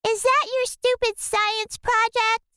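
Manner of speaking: high snotty sister voice; mock the science project. A narrator's reading. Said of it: "asked my sister Kate".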